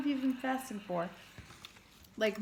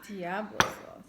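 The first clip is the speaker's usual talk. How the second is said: sexy voice; slower than usual. Pretend. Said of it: "That's how Kaki talks".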